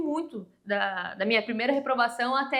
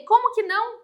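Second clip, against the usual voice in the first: incredulous; 9 semitones higher, 5 dB louder than usual. Retold or imitated. Caller disbelieves she failed.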